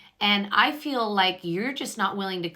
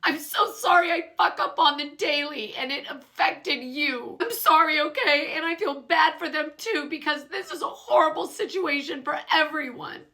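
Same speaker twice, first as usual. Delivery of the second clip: high, teary mock apology; imitating sobbing; 7 semitones higher than usual. Lisa apologising to Bronwyn through tears, mock sobbing. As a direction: sob the apology, pitched well up. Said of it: "sobbing again".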